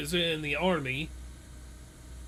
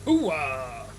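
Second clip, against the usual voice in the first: loud gruff movie soldier bark; a hint of Pacino's gravelly hooah; 5 dB louder than usual. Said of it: "That was Al Pacino style".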